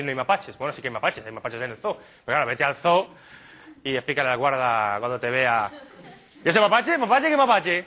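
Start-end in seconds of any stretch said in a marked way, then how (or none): 6.4-7.8 baffled zoo guard. Acted. Acted exchange with zoo guard.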